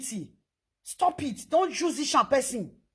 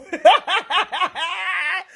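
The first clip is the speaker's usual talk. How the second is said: laugh; 6 semitones higher, 5 dB louder than usual. Real, not acted. Mocking the insulting crowd.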